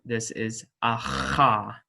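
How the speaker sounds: "aha" slow, rasped at the back; chosen uvular H. Shown as a demonstration. Teacher to students, settling the chosen sound. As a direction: slowly scrape the H in "aha". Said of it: "not how we're gonna pronounce this".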